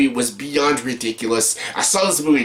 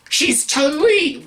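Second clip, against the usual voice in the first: mock outraged, much higher and slow; mocking disbelief; 13 semitones higher, much slower than usual. Sneering at the lead actress's casting, much higher and slow. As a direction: drag it out, scoffing at the casting.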